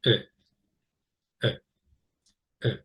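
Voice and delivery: short isolated "uh", with pauses between; schwa sound. Shown as a demonstration. Teacher demonstrating the schwa for learners, short detached "uh". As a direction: give "uh" as a brief unstressed schwa, each one separate. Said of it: "that sound is like this".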